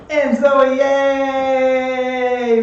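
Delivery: welcoming a student with a loud yay; excited greeting. Real, not acted. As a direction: cheer as she joins the call. Cheering a student joining class.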